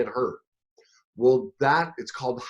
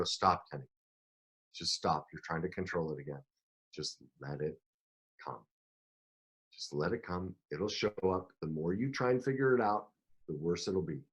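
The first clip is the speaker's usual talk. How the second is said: softer, lower voice talking himself down; soft self-talk; 6 semitones lower, 8 dB quieter than usual. Retold or imitated. Telling himself to stop controlling, soft and low. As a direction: gently hush your own racing mind.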